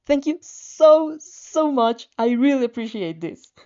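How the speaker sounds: slow, loud, stretched out thanks; gush, drawing out the so. A narrator's reading. Girl gushing thanks to Shoto, slow and loud. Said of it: "you gush".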